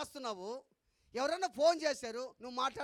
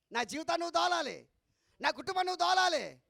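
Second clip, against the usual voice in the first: rising, louder preaching refrain; preaching at full force; 6 semitones higher, 5 dB louder than usual. Acted. Preacher driving the point to the congregation, full-force preaching. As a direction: hammer each line home to the congregation.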